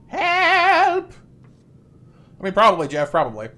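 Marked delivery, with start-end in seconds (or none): 0.1-1.1 very high, loud tiny cry for help; squeaky help. Pretend. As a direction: shriek it like a small creature.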